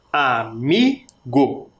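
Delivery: slow, each syllable of Amigo apart: a, mi, go; sílaba por sílaba. Shown as a demonstration. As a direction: break Amigo into three separate syllables. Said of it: "Separamos em três sílabas". (Amigo bem devagar, separando a-mi-go)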